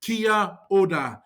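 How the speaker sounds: "Kia ora" slowly, word by word; slow demonstration. Shown as a demonstration. Teacher showing beginners how to read a greeting, "Kia ora" said slowly. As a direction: stretch out "Kia ora" for learners. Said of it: "read these words in yellow as if they are English".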